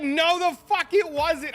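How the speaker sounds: high, loud, slow mock yell; imitating mom denying The Matrix was on. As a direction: hammer it like a disbelieving mother. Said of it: "And she's like".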